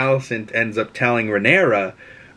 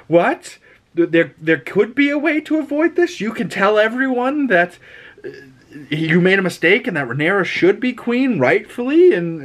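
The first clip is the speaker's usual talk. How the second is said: high-pitched, stunned pleading; shocked disbelief; 7 semitones higher than usual. Retold or imitated. Rhaenyra, retold, realizing the war could be avoided, shocked. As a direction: squeak it out in horrified disbelief. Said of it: "the look on Rhaenyra's face of like".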